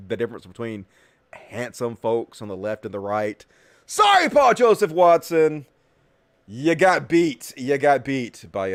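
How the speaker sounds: normal talking voice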